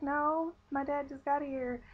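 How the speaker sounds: high-pitched mock plea to wait; pleading protest. Patient stalling staff because dad just arrived, pleading protest. Pleading against going into surgery.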